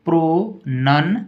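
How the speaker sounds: normal talking voice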